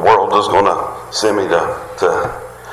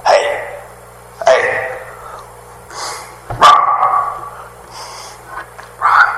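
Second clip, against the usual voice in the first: God calling out; 4 semitones higher, much slower than usual. Retold or imitated. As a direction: imitate God calling sharply, spaced by pauses. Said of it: "No matter what level he says it".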